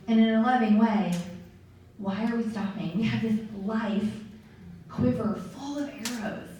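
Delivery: ordinary delivery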